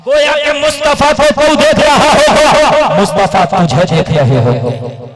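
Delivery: loud, driving sermon cadence; full-force preaching. Acted. Preacher urging the gathering to listen, booming. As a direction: preach it hard to the crowd.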